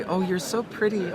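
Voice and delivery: put-on sugary niceness; fake sweetness. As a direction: gush insincerely, imitating a girl flattering her rival. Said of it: "It's so fake".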